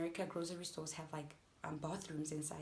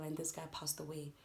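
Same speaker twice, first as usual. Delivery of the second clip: usual speaking voice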